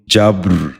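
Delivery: جبر said "jabr", no vowel between b and r; b running straight into a rolled r in جبر; correct pronunciation. Shown as a demonstration. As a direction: say جبر as one syllable, "jabr". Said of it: "دوبارہ سنیے".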